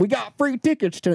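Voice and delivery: exaggerated small-town accent; Grand Junction accent. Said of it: "that was a Grand Junction accent".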